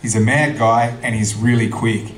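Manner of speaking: ordinary delivery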